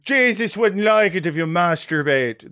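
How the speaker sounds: cartoon Irish priest accent; Lucky Charms Irish accent. Joking to the Irish guest, hammed-up Irish accent. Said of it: "I do a Lucky Charms accent".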